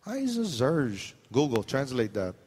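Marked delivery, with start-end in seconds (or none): 0.1-1.1 swaggering pidgin boast, slower at the end; a hint of a cocky pidgin speaker; playful pidgin voice. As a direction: brag like a proud local uncle. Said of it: "it's like".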